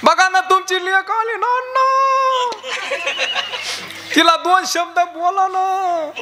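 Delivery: high, slow wailing plea over the dead; keen it like a grieving daughter; mock lament.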